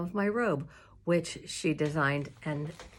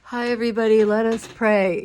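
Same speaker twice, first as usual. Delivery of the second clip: louder, higher hammed-up greeting; mock cheery greeting; 4 semitones higher, 10 dB louder than usual. Pastor greeting the congregation on camera, hammed-up cheery greeting. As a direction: ham up a cheery pastor's hello. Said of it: "you're not like".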